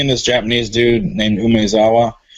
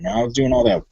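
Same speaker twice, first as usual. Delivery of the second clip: ordinary delivery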